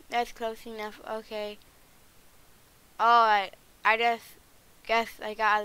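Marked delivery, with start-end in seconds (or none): 3.0-5.6 louder flustered break-off. Acted. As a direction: pull back awkwardly, louder. Pulling back from hug.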